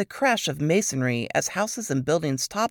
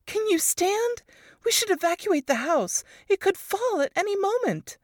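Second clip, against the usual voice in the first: put-on high, urgent young woman; Varys urging. A narrator's reading. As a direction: press her to leave quickly. Friend urging evacuation.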